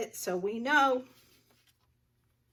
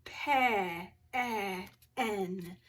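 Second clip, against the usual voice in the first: "pe- e- en" with the short eh stretched and repeated; slow sounding-out; 3 semitones lower, much slower than usual. Shown as a demonstration. Teacher checking pen for its vowel, slowly sounded out. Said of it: "let's say 'em slow".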